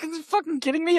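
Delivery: high, far-up incredulous squeal; mock outrage. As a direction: screech it in disbelief. Outraged at double standard.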